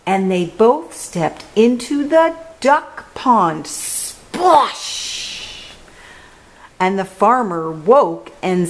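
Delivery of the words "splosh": high, hushing splosh; splash sound. A narrator's reading. Thieves step into the pond, a big vocal splosh. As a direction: draw out the splash noise.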